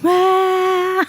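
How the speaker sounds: high-pitched mock gremlin wail; gremlin scream. Podcaster imitating the exiled man's screams, mock gremlin scream. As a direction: imitate a little gremlin's screech. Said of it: "the little gremlin screams he was doing".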